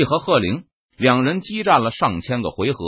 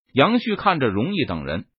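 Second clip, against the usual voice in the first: ordinary delivery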